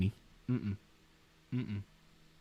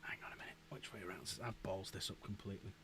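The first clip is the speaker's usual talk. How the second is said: whisper; 6 semitones lower, 23 dB quieter, faster than usual. Real, not acted. Muttering to himself while building.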